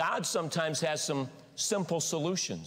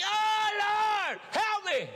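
desperate cry to the Lord; 12 semitones higher, much slower than usual. Pretend. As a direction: beg the Lord at the top of your voice. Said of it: "I'm like".

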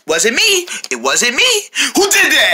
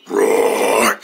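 bear roar. Acted. Bear lurking nearby.

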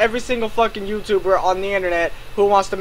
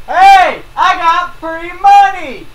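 high, loud, drawn-out mocking shout; mock scammy YouTuber; 7 semitones higher, 6 dB louder, slower than usual. Mocking a YouTuber promising cash, hammed-up shout. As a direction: call it out like a dumb hype YouTuber. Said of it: "what kind of YouTuber goes out there and says".

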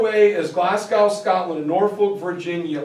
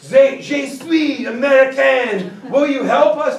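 put-on Clark's clumsy French-accented American, higher and louder; mock French accent; 6 semitones higher, 5 dB louder than usual. Spy announcing himself to French soldiers, hammed-up French accent. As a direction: butcher the French on purpose like a terrible spy.

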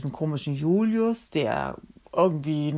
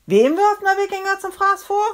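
very high, loud shriek of a question; high-pitched combative yell; 9 dB louder than usual. Acted. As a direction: shout it frantically mid-fight. Yells question mid-fight.

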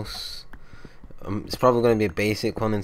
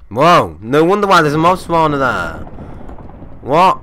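loud, higher, amazed exclamations; startled discovery; 8 semitones higher, 11 dB louder than usual. Real, not acted. Gamer spotting a hidden mob spawner, loud amazement. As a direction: gasp at the unexpected find.

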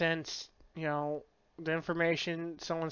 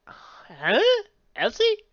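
startled recognition; 9 dB louder, much slower than usual. Retold or imitated. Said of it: "He literally calls her by her twin sister's name".

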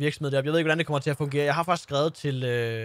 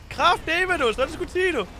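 real excitement over the new bus; thrilled; 9 semitones higher than usual. Real, not acted. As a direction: cheer it like you just won. Thrilled after getting the bus.

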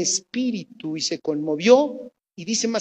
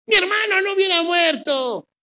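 crying reproach; 10 semitones higher than usual. Retold or imitated. Marta reproaching Jesus, in a hammed-up tearful wail. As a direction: wail the complaint like a hurt sister.

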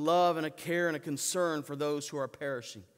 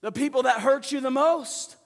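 much higher, much louder, urgent; Paul's urgent plea; 10 semitones higher, 11 dB louder than usual. Retold or imitated. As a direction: plead it like Paul to the believers. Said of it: "Paul is telling the Ephesian believers".

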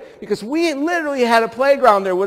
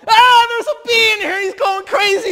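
high, loud panicked yell; panicked scream; 13 semitones higher, 4 dB louder than usual. Retold or imitated. Preacher voicing the boy screaming at the bee, high panicked yell. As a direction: shriek like a kid trapped with a bee.

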